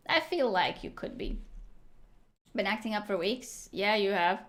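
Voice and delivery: ordinary delivery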